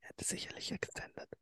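hushed whisper. Real, not acted. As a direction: whisper so no one wakes up.

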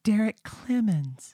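slow, high imitating voice; [name] impression. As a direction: echo her reading of the name, slowly. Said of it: "Then I'm gonna have to impersonate Reva".

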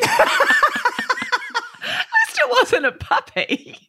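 high, laughing through the words. Real, not acted. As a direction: crack up mid-sentence. Laughing reply about gift plant.

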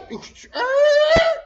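loud, very high screech; imitated screeching halt. Stopping the machine mid-flight, loud imitated screech. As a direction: mimic a machine braking hard.

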